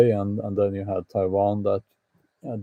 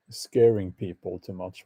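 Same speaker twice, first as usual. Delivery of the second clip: normal talking voice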